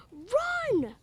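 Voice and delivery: high, loud yelled warning; shout run. Acted. Michael shouts to the others to flee the hawk. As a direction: yell it to get them running. Kids flee the mama bird.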